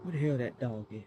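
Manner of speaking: real fear, hushed; scared. Real, not acted. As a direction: murmur while eyeing a big dog. Scared of dog nearby.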